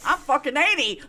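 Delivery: one-up them crossly, half laughing; mock-outraged old man's comeback; imitating old neighbor. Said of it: "And he's going".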